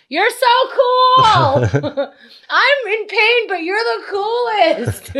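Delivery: high, slow mock-adoring voice; high breathless fake admiration; mock fawning. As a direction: gush up high and drawn out like a starstruck fan. Mock adoring the bragging guy.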